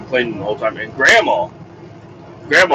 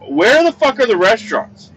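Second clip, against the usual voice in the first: much higher, outraged question; exasperated outburst; 10 semitones higher than usual. Retold or imitated. Hungry dad at the meat market, exasperated outburst. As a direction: demand it in disbelief.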